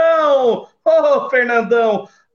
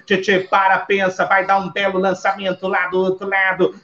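brisk football narration; play-by-play commentary; 4 semitones lower than usual. Acted. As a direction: call the match live.

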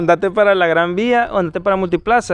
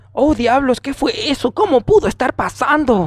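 higher, theatrical dubbed exclamation; Spanish dub voice; 5 semitones higher than usual. Acted. Friend mocking stilted Spanish dubbing, theatrical dub voice. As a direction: overact like a badly translated series. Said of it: "las traducciones que le ponen son".

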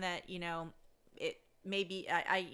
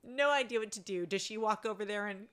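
normal talking voice

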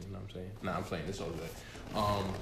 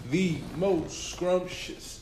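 drawn out; 9 semitones higher, 4 dB louder, much slower than usual. Acted. Drawn out hype intro.

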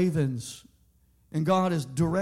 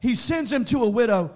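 preaching; 3 semitones higher, 6 dB louder than usual. Acted. Preaching at full force.

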